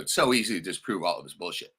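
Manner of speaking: ordinary talk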